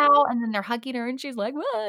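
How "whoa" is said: high stunned whoa; mock whoa. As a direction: play her stunned gasp. Retold stunned reaction.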